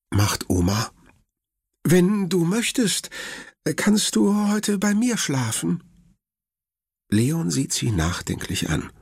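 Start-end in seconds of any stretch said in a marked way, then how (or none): 1.8-5.8 high, lightly voiced worried grandmother; grandma's voice. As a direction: speak up high as the fussing grandma. (hohe, besorgte Oma-Stimme)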